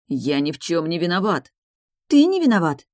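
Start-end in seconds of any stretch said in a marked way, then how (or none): 2.1-2.9 high, loud squeal of outrage; indignant shriek. A narrator's reading. Enina mocking his claim of innocence, indignant shriek. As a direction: throw it back as a shriek. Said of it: "взвизгнула она".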